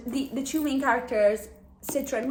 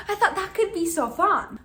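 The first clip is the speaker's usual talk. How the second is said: laughing; 7 semitones higher, 4 dB louder than usual. Real, not acted. Laughing about shipping villain.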